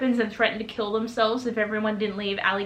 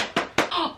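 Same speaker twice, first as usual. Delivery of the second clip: sharp gasp; 6 semitones higher, 3 dB quieter than usual. Retold or imitated. Shocked reaction to the battle.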